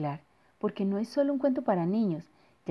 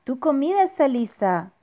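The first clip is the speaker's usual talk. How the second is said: calling out; 6 semitones higher, 6 dB louder than usual. Acted. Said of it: "dijo mamá".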